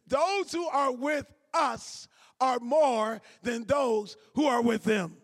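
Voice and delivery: slow, weighty reading that leans on every word; emphatic scripture reading. Shown as a demonstration. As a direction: lay each word down slowly so the verse lands. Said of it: "You see it highlighted on the screen".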